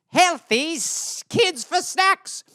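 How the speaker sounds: very slow, high-pitched villain voice; hammed-up creepy delight; sinister gloating. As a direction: draw out each word with ghoulish glee. Sinister misreading, acted.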